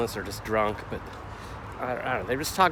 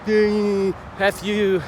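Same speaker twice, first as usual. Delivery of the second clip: loud, high mocking shout of abuse; rude guy yelling back; 7 semitones higher, 8 dB louder, much slower than usual. The bike man swearing back at him, loud hostile shout. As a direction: throw the insult right back at him. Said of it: "He's like".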